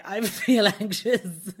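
laughing through a slow mock complaint; half-laughing self-parody; laughing mock whine. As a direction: giggle while quoting her own whining. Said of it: "I'm like".

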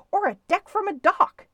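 high, loud story-book scream. A narrator's reading. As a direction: scream it back, pitched right up. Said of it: "screamed Stewart".

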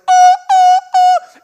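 very high, loud alarm wail; alarm imitation. Pastor imitating the alarm that keeps going off, a very high loud wail. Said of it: "It is just like".

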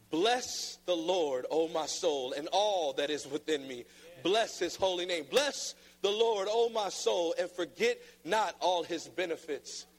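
solemn psalm reading; scripture reading. Acted. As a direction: read the verse with weight.

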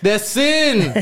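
laughing. Real, not acted. Joking punchline about sin.

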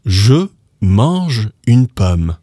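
"Je mange une pomme" very slowly; slow careful French. Shown as a demonstration. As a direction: lay out each word of "Je mange une pomme". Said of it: "I am eating an apple".

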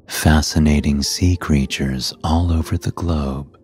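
ordinary delivery, nothing marked

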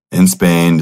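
usual speaking voice

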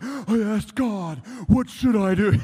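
slow, high, loud overblown plea; mock dramatic prayer. Preacher mocking a dramatic prayer, loud and overblown. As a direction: ham up a fisherman crying out to God.

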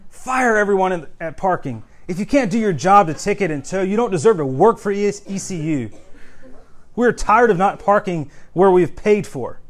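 loud, high, fed-up tirade; mock angry rant. Preacher reading a parking rant, mock fury. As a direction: deliver an acted, indignant demand. Performing printed pirate rants.

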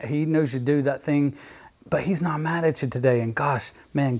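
ordinary talk

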